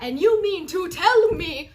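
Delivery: loud, high indignant rise; angry outburst. Real, not acted. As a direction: build up to a rant. Outraged rant building.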